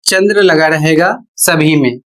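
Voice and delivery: normal talking voice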